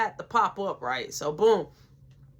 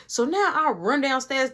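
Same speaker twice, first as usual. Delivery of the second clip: ordinary delivery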